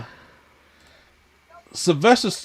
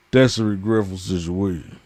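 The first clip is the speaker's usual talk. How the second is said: put-on Stallone accent; 6 semitones lower than usual. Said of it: "If you're not gonna do it in the accent".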